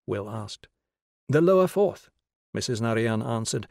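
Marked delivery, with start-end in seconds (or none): 1.3-2.1 higher, louder, brisk woman's answer; schoolteacher answering. A narrator's reading. Teacher answering a visitor's question, brightly raised voice. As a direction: answer up high and firmly.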